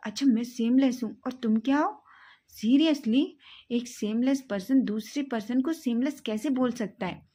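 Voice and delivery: outraged shouting in a quarrel; indignant shouting. Acted. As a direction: hurl the insult right back. Shouting match, insult returned.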